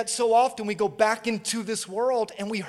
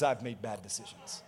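much softer and lower; quiet admission; 10 semitones lower, 12 dB quieter than usual. Real, not acted. Preacher admits his own fault to the congregation, quiet aside. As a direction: drop it to a low confessional aside. Confesses the same, dropping his voice.

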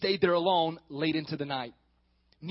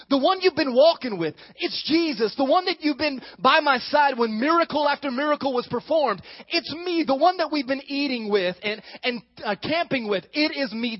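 higher, louder earnest plea; Jesus reassuring; 6 semitones higher, 5 dB louder than usual. Retold or imitated. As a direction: call out to frightened friends. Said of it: "what he was saying was".

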